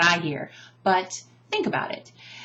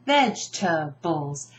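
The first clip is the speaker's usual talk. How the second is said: "Vegetables" slowly as VEJ-tuh-bulls; "Vegetables" in three beats, first one strongest; stress on first syllable; 3 semitones lower, slower than usual. Shown as a demonstration. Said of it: "Which syllable gets emphasized?".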